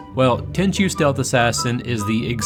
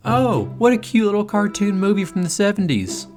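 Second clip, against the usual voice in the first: mock viewer voice; 9 semitones higher than usual. Mocking an imagined viewer.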